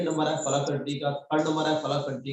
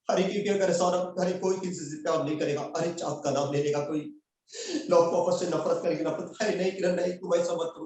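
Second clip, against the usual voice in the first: Darr dialogue; 3 semitones higher than usual. Acted. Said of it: "dialogues मेरा चालू है डर का".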